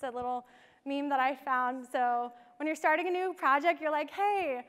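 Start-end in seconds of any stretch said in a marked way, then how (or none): 4.1-4.6 a higher, louder burst of keen enthusiasm; eager mock hey. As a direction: play the go-getter jumping in. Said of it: "this is my attitude".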